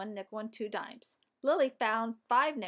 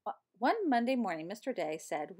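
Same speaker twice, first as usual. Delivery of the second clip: usual speaking voice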